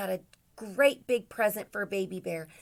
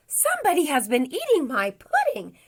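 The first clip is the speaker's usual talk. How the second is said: loud, raised storybook bear voice; Papa Bear's great big voice; 7 semitones higher, 8 dB louder than usual. A narrator's reading. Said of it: "in a great big voice".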